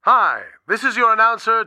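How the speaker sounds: put-on booming old-time radio intro; announcer voice. Shown as a demonstration. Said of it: "Let's hear what I sounded like".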